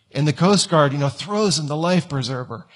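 ordinary talk

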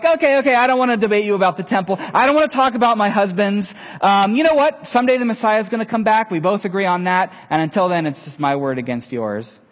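high, loud, fast rush of excuses; flustered brush-off. Retold or imitated. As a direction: talk her way out quickly, voice up high. Woman dodging, changing subject.

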